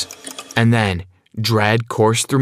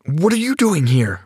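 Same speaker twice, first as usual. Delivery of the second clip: higher-pitched burst of surprise; startled outburst; 7 semitones higher than usual. Acted. Brad finds Sarah at the door, startled outburst. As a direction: blurt it out, caught off guard. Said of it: "I burst out".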